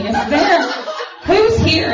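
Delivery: a frantic dad hunting for help; eager dad calling out. Retold or imitated.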